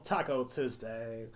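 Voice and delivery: ordinary talk, nothing marked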